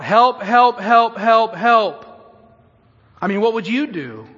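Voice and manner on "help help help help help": high, loud, urgent repeated cries; desperate shouts, one after another; yelling for help. Acted. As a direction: cry out for help over a crowd. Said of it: "he begins yelling out".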